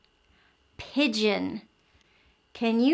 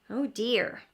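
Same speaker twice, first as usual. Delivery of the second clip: plain reading voice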